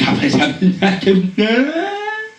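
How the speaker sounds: high nervous fake laugh; awkward high giggle. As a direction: let an awkward giggle spill out. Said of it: "all that comes out".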